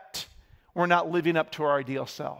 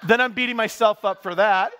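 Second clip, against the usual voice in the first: usual speaking voice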